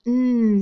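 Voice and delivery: "N" as a long hummed nnn; "N" sustained like a hum; isolated letter sound. Shown as a demonstration. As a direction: hold "N" as a pure sound, not the letter name. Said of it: "we heard the N sound".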